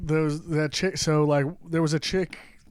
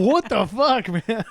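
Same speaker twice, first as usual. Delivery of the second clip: louder, higher stunned outburst; shocked reaction; 6 semitones higher, 4 dB louder, slower than usual. Retold or imitated. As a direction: react as if you can't believe it. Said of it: "you're like".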